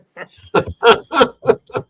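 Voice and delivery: loud high-pitched burst of laughter; laughing. Real, not acted. Guest capping an old boat owners' joke, loud high laughter.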